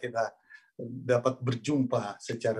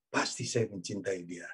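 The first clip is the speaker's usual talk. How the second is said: lightly; 5 semitones lower, 6 dB quieter than usual. Retold or imitated. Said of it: "Adam jawab dengan ringannya".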